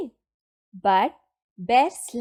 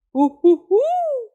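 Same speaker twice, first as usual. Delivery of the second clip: high, loud hooting ho-ho; jolly high-pitched holler; little Santa's cry; 10 semitones higher, 7 dB louder than usual. A narrator's reading. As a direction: hoot it out high as a tiny Santa. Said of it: "cried the little Santa Claus".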